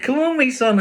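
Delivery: very high, loud call; rally the puppy along. Acted.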